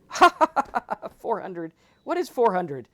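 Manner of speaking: loud high cackle, then mocking questions; guru laughing it off. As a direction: laugh down at the big number.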